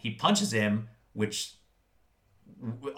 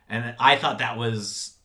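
ordinary delivery

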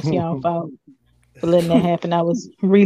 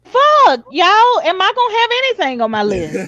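exasperated outburst; 9 semitones higher than usual. Real, not acted. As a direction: burst out in frustration. His picks keep getting taken.

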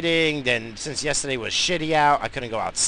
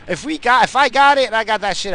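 raised, louder insistence; loud emphatic; 9 semitones higher, 8 dB louder than usual. Real, not acted. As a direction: push it out hard. Insisting he's fine.

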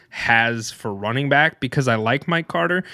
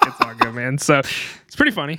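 ordinary talk

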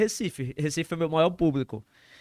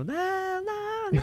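mock screaming; 9 dB quieter than usual. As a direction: wail it like your life is over. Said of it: "eu saí gritando".

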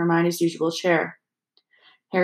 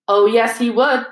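raised, forceful contradiction; loud retort; 4 semitones higher, 5 dB louder than usual. A narrator's reading. Ron contradicting Lockhart in class, loudly. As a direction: push back hard and loud. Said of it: "even more loudly than Dean".

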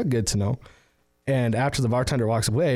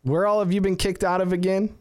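higher-pitched mock call-out; mild mock yell, pitched up; retold yell; 7 semitones higher than usual. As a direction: imitate a bartender calling across the bar, without real volume. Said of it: "the bartender yells at him".

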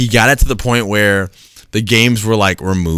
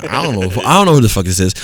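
usual speaking voice

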